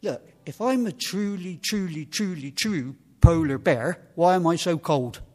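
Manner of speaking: loud, high, slowing on each repeated truly; exasperated young bear. Acted. Polar bear son answering his worried family, loud mock exasperation. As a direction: build emphatically to the punchline. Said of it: "he said".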